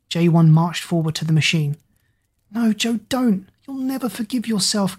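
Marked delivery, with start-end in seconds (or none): 2.5-5.0 higher, softer pleading, out of breath; panting. Acted. Kira pleads with Joe to stop, higher breathless begging. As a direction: beg him between breaths. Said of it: "Kira panted, gasping for breath".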